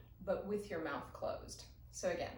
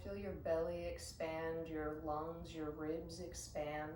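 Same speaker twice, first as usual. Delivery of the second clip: calm, unhurried meditation guide; guided meditation. Acted. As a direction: guide them gently into the breath. Leads a breathing meditation.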